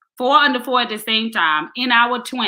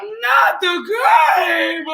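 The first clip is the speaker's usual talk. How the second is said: mock sobbing plea; 8 semitones higher, much slower than usual. Wife pleading over cable bill, laughing sob. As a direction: cry it out, half laughing. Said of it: "I was like".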